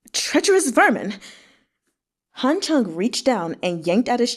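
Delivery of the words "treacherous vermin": higher, spat-out curse; a hint of a beaten prisoner cursing his captor; bitter curse. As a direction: hurl the insult from the ground. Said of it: "He collapsed in the rainwater and cursed".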